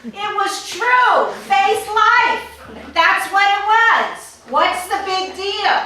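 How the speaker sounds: indignant emphatic insistence; defiant. Real, not acted. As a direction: dig in and refuse to apologise. Defiant defending her words.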